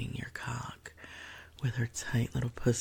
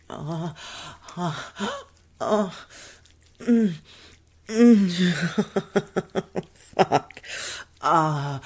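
rising high, loud moaning; climax moans; 5 semitones higher, 7 dB louder, much slower than usual. Acted. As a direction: build to peak moans.

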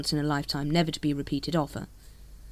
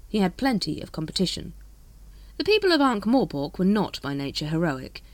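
ordinary delivery, nothing marked

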